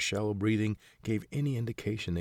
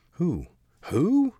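building to a much higher, louder irritated cry; impatient old man, getting louder; the second "Who?" snapped much higher; rising irritation; 12 semitones higher, 10 dB louder than usual. Acted. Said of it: "The voice rose in irritation".

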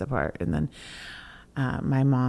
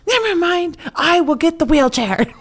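much higher, louder bossy auntie ordering; Aunt Lorraine; 7 dB louder than usual. Retold or imitated. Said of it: "My bossy auntie".

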